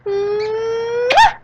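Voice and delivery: loud, high "mwah"; a big exaggerated kiss noise; blown kiss. Streamer signing off to viewers, blown kiss.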